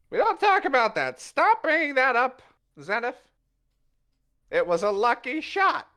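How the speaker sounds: high, loud protest; defensive bluster. Acted. Puss snapping at Zenith about his wound, high defensive bluster. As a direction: huffily shut the topic down.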